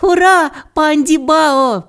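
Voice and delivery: drawn-out, high-pitched celebration; cheering. A narrator's reading. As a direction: cheer the heroes as a crowd would. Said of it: "пролунало в лісі".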